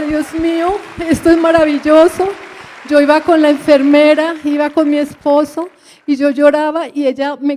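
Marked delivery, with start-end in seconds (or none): 0.0-2.3 higher and louder, overcome with joyful tears; retelling her tearful joy at the baby moving. Retold or imitated. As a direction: cry out in joy to God, voice lifted. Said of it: "y el niño se movió y yo lloraba".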